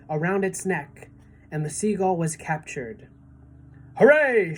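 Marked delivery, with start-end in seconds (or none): 4.0-4.6 high, loud cheer; shouted hooray. A narrator's reading. Old green grasshopper cheering James from the tunnel, loud. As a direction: cheer the capture. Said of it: "shouted the old green grasshopper".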